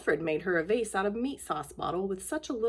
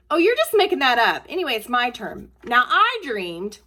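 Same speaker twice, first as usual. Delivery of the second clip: loud, higher, mocking, slowing at the end; scornful sibling; 4 semitones higher, 9 dB louder than usual. A brother scoffs and takes over the dream telling. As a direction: butt in and grab your turn.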